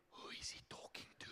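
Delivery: soft, unvoiced whisper; whispered question. Dad at the door asking about his praying, whispered. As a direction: breathe it so nobody hears.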